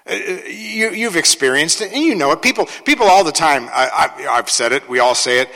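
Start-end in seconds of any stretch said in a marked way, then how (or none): none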